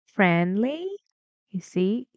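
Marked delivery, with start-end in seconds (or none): 0.1-0.9 "Friendly" sweeping up on "ly"; rising intonation. Shown as a demonstration. Teacher shows it goes higher on L Y, rising "Friendly". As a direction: let the end of "Friendly" climb steadily. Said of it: "it goes higher on L Y".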